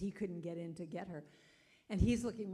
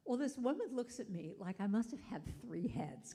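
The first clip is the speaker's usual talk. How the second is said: usual speaking voice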